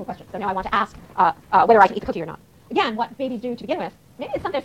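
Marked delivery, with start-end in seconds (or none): none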